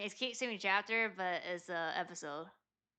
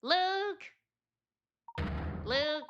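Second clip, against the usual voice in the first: calling out; 9 semitones higher than usual. Acted. Calling for Luke in game.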